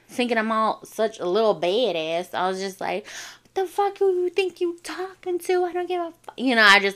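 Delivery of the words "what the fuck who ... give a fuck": high-pitched retold outburst; sassy high-voiced tirade, retold; mock going off. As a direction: act out going off on a bully, without shouting. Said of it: "like, went off".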